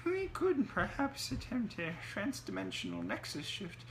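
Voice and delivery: high nasal wizened gremlin scholar; fussy, humming academic gremlin with little chuckles; Tickleback. A narrator's reading. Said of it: "the nasal voice of Tickleback".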